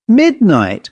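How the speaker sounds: high startled owl; startled cry. A narrator's reading. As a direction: cry it out in alarm. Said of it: "cried the owl, evidently much startled".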